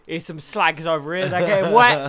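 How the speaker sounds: Danny Dyer. Acted. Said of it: "Danny Dyer telling you the weather".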